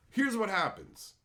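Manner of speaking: normal talking voice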